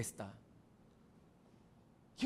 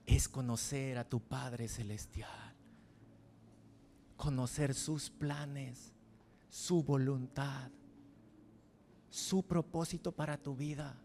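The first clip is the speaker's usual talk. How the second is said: tender slow; 3 semitones lower, 5 dB quieter than usual. Acted. Tender closing invitation.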